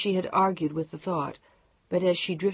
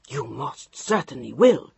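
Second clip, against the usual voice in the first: low, firm, unbending; a hint of a steely husband; quiet steel; 4 semitones lower, 5 dB louder than usual. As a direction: state it as final law. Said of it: "His voice was quiet and as solid as steel".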